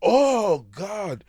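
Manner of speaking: hammed-up startled Oh at the word God; surprised Finn. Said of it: "He says".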